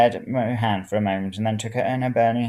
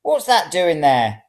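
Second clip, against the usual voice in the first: put-on outraged old woman; 7 semitones higher, 5 dB louder, slower than usual. A narrator's reading.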